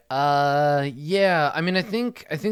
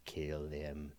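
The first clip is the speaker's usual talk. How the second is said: low, hushed sinister command; necromancer voice; 7 semitones lower, 15 dB quieter than usual. Acted. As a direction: murmur orders like a creepy necromancer. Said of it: "That's my necromancer impression".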